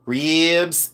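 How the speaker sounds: RIBS stretched long as "reebs"; mock Southern "reeeebs"; imitated Southern drawl. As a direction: draw out the vowel in RIBS, mimicking Southerners. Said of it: "I love their accent".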